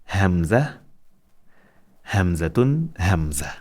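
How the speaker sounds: Hamza, Hamzatun rising, Hamza falling; teacher modelling the Arabic letter Hamza; letter name drill. Shown as a demonstration. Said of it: "One more time".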